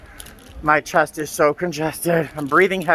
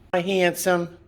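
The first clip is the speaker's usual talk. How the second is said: higher sing-song goodbye to the pet; pet talk goodbye; 5 semitones higher, slower than usual. Real, not acted. Owner cooing goodbye to her cat, in a higher sing-song. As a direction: coo a fond farewell.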